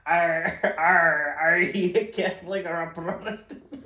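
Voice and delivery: put-on Irish accent sliding into pirate, loud, with laughter; failed Irish accent. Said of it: "I'm trying to do an Irish accent, but... pirate".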